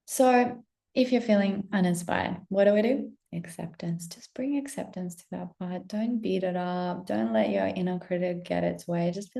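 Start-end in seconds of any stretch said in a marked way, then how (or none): 3.3-5.9 breathy whispered advice; whispered gentle guidance; soft whisper. Acted. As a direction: hush it like a secret.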